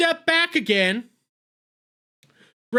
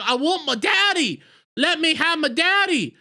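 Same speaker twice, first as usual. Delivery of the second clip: put-on whiny little girl; 6 semitones higher than usual. Girl pleads for daddy.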